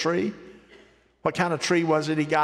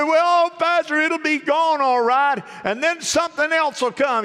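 a mocking doubter, higher and louder; 11 semitones higher, 4 dB louder than usual. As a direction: play the sceptic in the crowd, pitched high and loud. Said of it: "You say".

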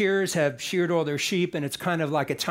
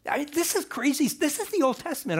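amazed aside; 8 semitones higher than usual. Real, not acted. Preacher marvels at the story.

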